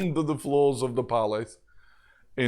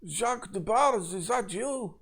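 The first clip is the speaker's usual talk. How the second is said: put-on higher, hopeful French accent; 7 semitones higher than usual. Prisoner calling to an old friend, French-accented hope. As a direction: call out as a Frenchman. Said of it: "a French accent from another cage".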